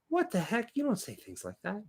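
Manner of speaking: ordinary talk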